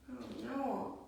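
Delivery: plain reading voice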